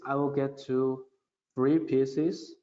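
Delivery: ordinary talk, nothing marked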